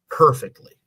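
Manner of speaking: ordinary talk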